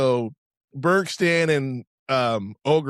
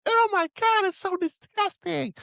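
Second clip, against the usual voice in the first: squealed falsetto, much higher; hammed-up high squeal, drawing out the disgust; disgusted girl. Podcaster imitating the girl after the nose wipe, disgusted squeal.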